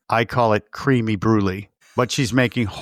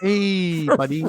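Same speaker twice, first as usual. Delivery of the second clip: awkward gentle dad; 5 semitones higher, slower than usual. Retold or imitated. Mimicking dad's awkward hey buddy talk.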